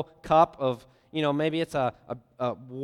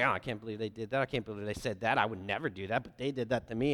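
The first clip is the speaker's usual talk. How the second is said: low, soft grumbling complaint; mock judgmental grumble; muttered gossip; 6 semitones lower, 8 dB quieter, faster than usual. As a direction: mutter it resentfully under your breath. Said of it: "We're like".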